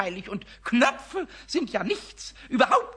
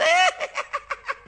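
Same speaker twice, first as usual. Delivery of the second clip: high, loud giggle; giggling kobold laugh; 5 dB louder than usual. Acted. Pumuckl giggles at his own prank, high giggle. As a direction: burst into cheeky giggles.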